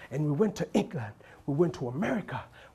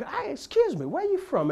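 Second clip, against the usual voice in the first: high, polite English-style asking; mock polite English; 11 semitones higher than usual. Comedian teasing an English stranger, mock polite English voice. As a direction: ask sweetly like a Londoner.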